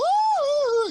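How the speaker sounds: loud high mock panic scream. Telling about the bed bugs, loud high mock scream. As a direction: let out a shrieked "Ah" in fake terror. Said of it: "I'm screaming".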